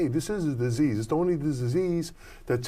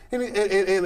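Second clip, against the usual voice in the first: normal talking voice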